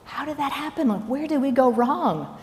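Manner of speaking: puzzled disbelief. Pretend. Puzzled rhetorical question.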